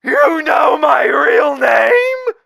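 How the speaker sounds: high, loud, slow shocked cry; hammed-up outraged disbelief; shocked outcry. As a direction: wail it out, caught red-handed. Shocked his name is known.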